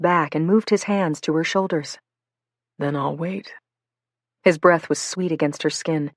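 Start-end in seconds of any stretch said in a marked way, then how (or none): 2.8-3.5 soft, tender promise; soothing. Acted. Marcus promising to wait for her, soft and tender. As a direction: say it like balm, softly. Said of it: "his words like balm".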